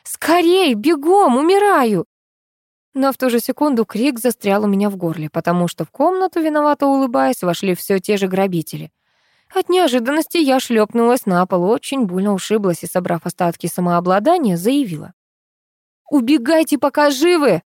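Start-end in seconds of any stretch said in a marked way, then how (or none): 0.0-2.1 high, urgent cries for help; frantic high-pitched plea; desperate yelling. A narrator's reading. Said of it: "завопила я". 16.1-17.6 high-pitched, bold threat; raised, theatrical ultimatum; dramatic warning. A narrator's reading. Heroine musters courage to threaten robbers.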